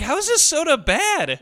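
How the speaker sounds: high, slow incredulous question; exaggerated bafflement; mock disbelief. Acted disbelief at bad soda.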